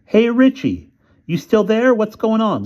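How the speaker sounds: high, loud impatient call down the phone; yelling. Acted. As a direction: shout into the receiver, fed up. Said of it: "began to yell".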